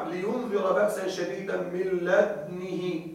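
"لدنه" read slowly with the dal rounded toward damma (ishmam); Quranic recitation demo. Shown as a demonstration. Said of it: "لدنه مع إشمام الدال". (تلاوة "لدنه" مع إشمام الدال ببطء)

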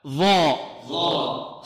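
ظاء drawn out very slowly and heavily; very slow emphatic ظاء for the class; articulation drill. Shown as a demonstration. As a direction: hold ظاء long with the tongue between the teeth. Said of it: "انطقوا معي".

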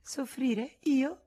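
high, incredulous echo; feigned astonishment. Acted. Rosanette pretends amazement at Frédéric's reproach, high and incredulous. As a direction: act wide-eyed innocence.

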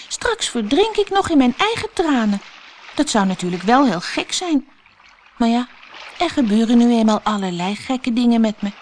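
put-on high, light girlish Alice voice; Alice's voice. A narrator's reading. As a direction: voice young Alice, dismayed and high. Alice swimming, talking. (hoog meisjesstemmetje van Alice, ontsteld)

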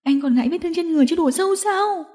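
acted female outcry, rising pitch; yelling. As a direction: shout at him in anguish, held back. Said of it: "Diệu Tinh hét to".

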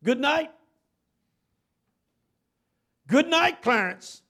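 good night yelled high and loud; yelling. Retold or imitated. Pastor yelling farewell at the corpse, high loud shout. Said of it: "he yelled at the corpse".

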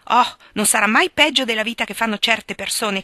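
put-on young woman answering boldly; defiant retort. A narrator's reading. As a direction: talk back to her aunt.

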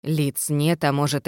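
ordinary delivery

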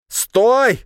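very high, loud shout. A narrator's reading. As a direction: yell it to stop him. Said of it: "выкрикнул он".

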